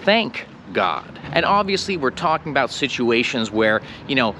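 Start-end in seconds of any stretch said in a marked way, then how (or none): 0.0-1.2 "Thank God" with no s, relieved fall; correct Thank God. Shown as a demonstration. Teacher modelling "Thank God" as a sigh of relief. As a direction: say "Thank" without an s before "God". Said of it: "You're supposed to say".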